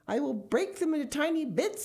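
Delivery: high, urgent vow; anxious high-pitched assurance; fervent promise. A narrator's reading. Mister Gregg promising under threat.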